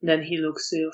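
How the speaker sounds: ordinary talk, nothing marked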